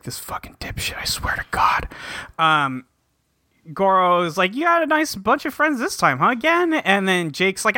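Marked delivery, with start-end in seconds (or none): 0.0-1.9 hissed whisper of disgust; whispered curse. Acted. Ryusei cursing Gen to himself in a hissed whisper. 4.6-6.7 put-on lifted, teasing older voice; teasing high voice. Goro teasing Gen about his friends, higher teasing voice.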